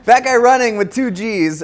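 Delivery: very high, very loud read-out; shouted announcement. Pretend. Host reading out a questioner's Twitter handle, shouted. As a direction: belt out the name like a big introduction.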